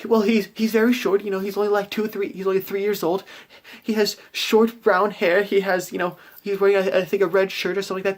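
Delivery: high anxious mother describing her son; an upset mother, voiced high. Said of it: "She's like".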